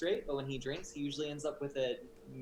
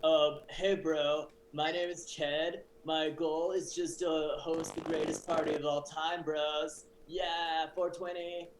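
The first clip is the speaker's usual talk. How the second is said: laid-back party bro; frat boy voice. Acted. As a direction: slack frat bro greeting his buddies. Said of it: "frat boy hetero voice".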